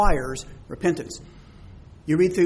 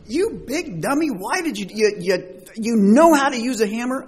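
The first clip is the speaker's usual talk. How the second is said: mock yell at himself; 6 semitones higher than usual. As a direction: scold yourself like a clumsy fool. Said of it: "I will yell at myself".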